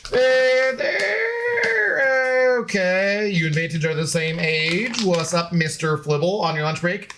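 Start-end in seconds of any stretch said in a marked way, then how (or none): 0.1-3.3 drawn-out they're okay. Shown as a demonstration. Streamer answering chat about the Seahawks, slow mock doubt. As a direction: drag out "they're okay" with a doubtful high lilt.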